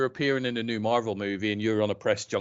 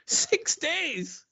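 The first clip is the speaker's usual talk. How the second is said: much higher, slow disbelieving scoff; incredulous scoff; 13 semitones higher, much slower than usual. Real, not acted. Podcaster scoffs at voters who expect instant change, high and slow. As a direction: stretch it out like you can't believe anyone would say that.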